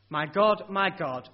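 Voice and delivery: my God, my God called out loud; loud cry. A narrator's reading. Preacher voicing Jesus on the cross, crying out loud. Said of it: "Jesus cried out in a loud voice".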